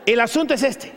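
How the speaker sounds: normal talking voice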